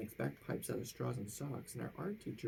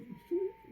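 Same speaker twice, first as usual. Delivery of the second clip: high little giggle. Acted. Principal starts to giggle, a high giggle. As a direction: let out a giggle. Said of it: "He soon began to giggle".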